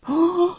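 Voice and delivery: high gasp of surprise; quick high intake of breath; sharp delighted gasp. Retold or imitated. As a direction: gasp like a fan watching the proposal. Audience reacting to proposal.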